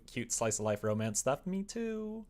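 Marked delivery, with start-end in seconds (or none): none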